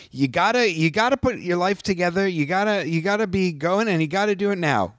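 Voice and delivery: mock dad lecture. Host mocks the father ordering his grieving daughter around, high and pushy. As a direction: nag like a preachy dad, mocking him. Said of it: "and he's like".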